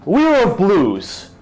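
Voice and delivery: jovial knight with his horse in a tavern; proud knight. Acted. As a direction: boast like an honored knight.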